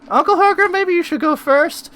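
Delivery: put-on very high, small girl voice; a tiny flustered girl asking for help; little girl voice.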